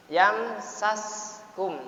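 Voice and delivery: Yamsaskum drawn out slowly with a clear sin. Shown as a demonstration. Teacher showing students the clear sin, slowly drawn out. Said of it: "dijelaskan sinya".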